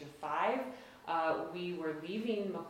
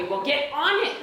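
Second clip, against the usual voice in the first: higher and louder, a mock exasperated shout; exasperated parent; 8 semitones higher, 10 dB louder, much faster than usual. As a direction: bark the order like a frantic dad. Parents urging on phone.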